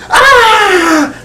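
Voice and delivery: high-pitched "Ah!" cry; showman's high-pitched yelp; sudden high yell. Pretend. Sudden cry during the trick.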